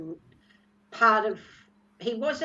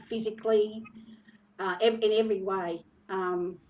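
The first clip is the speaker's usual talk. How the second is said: normal talking voice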